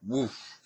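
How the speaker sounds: sudden loud, high "woof"; punchy whoosh mimicking a fast move; imitated whoosh. Man retelling a friend's military move, imitated whoosh.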